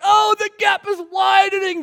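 high mock wail, loud then easing; dismayed outcry. Fans watching the French pull ahead, loud dismayed cry. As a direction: cry out in exaggerated despair.

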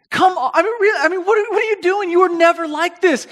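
high, loud exasperated scolding; frustrated mom. Retold or imitated. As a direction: imitate a mother losing patience with her kid. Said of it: "she's so frustrated".

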